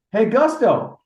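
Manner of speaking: yelling to the dugout. Retold or imitated. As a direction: shout it over to your buddy on the bench. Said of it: "I yelled in my dugout".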